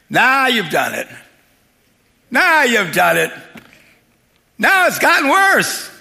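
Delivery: loud, high mock blaming; negative Nelly. Onlookers blaming Jesus for the boy, loudly complaining. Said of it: "people just negative Nellies".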